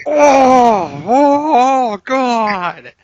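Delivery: high, loud anguished wail; imitated yelling. Gym lifter hit by a horrible smell, yelling in mock agony. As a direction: cry out in hammed-up agony. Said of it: "he's yelling at everyone around him".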